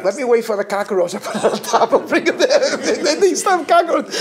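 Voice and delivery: can barely get the words out for laughing; laughing through it. Retold or imitated. Telling his brother's dorm joke, laughing through the punchline.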